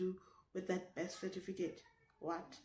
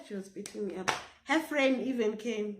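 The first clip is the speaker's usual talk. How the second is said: ordinary delivery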